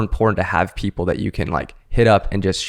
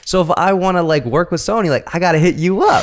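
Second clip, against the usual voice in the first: excited; 8 semitones higher than usual. Retold or imitated. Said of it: "And I was like".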